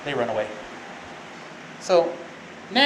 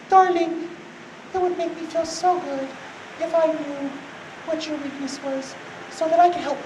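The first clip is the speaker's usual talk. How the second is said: high-pitched mock feminine wheedling; coy flirt; 9 semitones higher, 3 dB quieter than usual. Delilah coaxing Samson for his secret, coy wheedling. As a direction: sweet-talk in a put-on high voice. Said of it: "batting her eyes like we all know".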